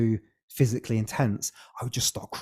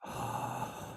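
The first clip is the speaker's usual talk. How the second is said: a sharp, voiceless gasp for air; hyperventilating gasp; 13 dB quieter than usual. Shown as a demonstration. Guest demonstrating the rebirthing breath to the host, gasping. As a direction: show the breathing with one quick gasp in. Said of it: "you're doing that".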